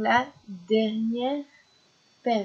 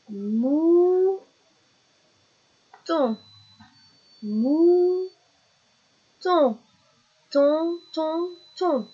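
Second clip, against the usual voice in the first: "Mouton" split with long high "mou", then "ton" three times; loud, high, drawn-out syllables for a learner; syllable drill; 7 semitones higher, 5 dB louder, much slower than usual. Shown as a demonstration. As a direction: stretch "mou", isolate and repeat "ton". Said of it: "La dernière syllabe de mouton".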